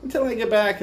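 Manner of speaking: normal talking voice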